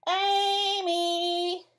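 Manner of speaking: high sung-out loud yell. Acted. Brother running upstairs yelling to wake Amy. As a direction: yell up the stairs as loudly as possible. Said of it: "yelling as loudly as he could".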